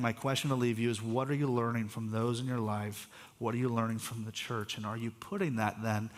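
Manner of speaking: ordinary talk, nothing marked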